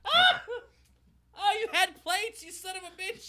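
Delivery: pained yelling. Real, not acted. Burned hand on hot dog.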